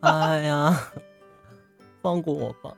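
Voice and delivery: usual speaking voice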